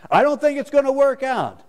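high, loud whining; high mock whine; whiny reluctance. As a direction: sulk like a child.

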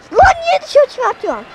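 high, loud protest; annoyed outburst. Real, not acted. Gamer scolding the game for sending the ball out, loud. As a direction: complain sharply at the game.

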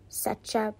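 ordinary delivery, nothing marked

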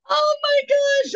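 real horror breaking into a high cry; shocked. Real, not acted. [name] reacting to the salesman dismissing VIP, shocked cry.

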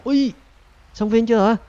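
sudden high loud alarmed cry; shocked shout; startled yelp. Real, not acted. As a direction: yelp in shock at the near miss. Near crash into a rabbit.

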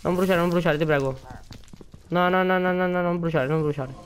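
anxious ti prego; desperate pleading. Real, not acted. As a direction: plead with the fire to stop. Begging items not burn.

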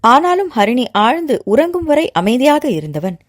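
ordinary delivery

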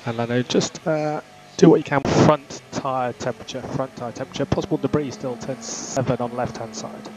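crackly team radio transmission; race car radio. Acted. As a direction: talk like over F1 pit radio.

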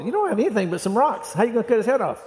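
high-pitched doubting question; acted incredulity. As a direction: challenge David as a disbelieving onlooker. Acts out sceptic questioning David.

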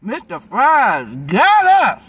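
high loud call out; shouting. Real, not acted. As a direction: yell it out, slow then high. Calling out loudly, echo remarked.

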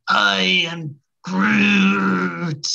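drawn-out Groot. Retold or imitated. Groot impression.

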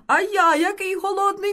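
high, loud piping wail; eager high-pitched exclamation; hungry little mouse. A narrator's reading. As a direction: outdo the other mouse's hungry cry. Second mouse outdoes the first's hunger.

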